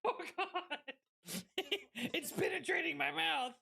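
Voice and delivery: high squeal through laughter; laughing outcry. Real, not acted. Streamer reacts to the drink, helpless laughing yell. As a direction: cry it out while cracking up.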